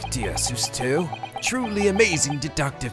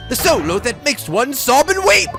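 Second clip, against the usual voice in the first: loud, high theatrical wail; dramatic lament; 9 semitones higher, 6 dB louder than usual. Acted. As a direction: mourn it grandly like a tragic poet. Holmes dramatic lament read aloud.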